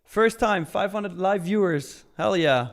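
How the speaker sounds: thrilled streamer cheer; excited celebration. Real, not acted. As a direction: celebrate hitting the milestone, loud and high. Viewer goal reached, celebrating.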